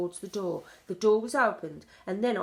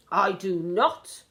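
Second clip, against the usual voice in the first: loud, slow, emphatic no; shouted; 7 dB louder, slower than usual. A narrator's reading. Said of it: "shouted the Grand High Witch".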